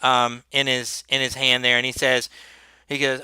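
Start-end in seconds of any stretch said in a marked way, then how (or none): none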